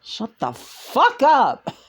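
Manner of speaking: high, slow, blunt Lena retort; Lena impression. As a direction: snap back as Lena, slowly. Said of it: "That's, that's Lena".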